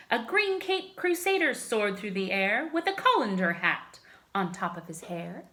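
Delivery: ordinary delivery, nothing marked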